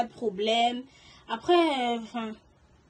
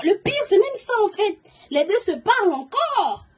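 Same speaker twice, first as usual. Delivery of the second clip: high, loud, slowed exasperation; exasperated outburst; 8 semitones higher, 7 dB louder than usual. Real, not acted. Storyteller telling viewers the worst part, exasperated and loud. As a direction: let the disbelief burst out. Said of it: "l'accent revient, parce que ça me dépasse".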